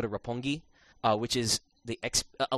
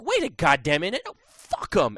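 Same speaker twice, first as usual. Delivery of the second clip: loud, defiant turnaround; defiant change of heart; 6 semitones higher, 6 dB louder than usual. Retold or imitated. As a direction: suddenly refuse to back down. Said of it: "you're like".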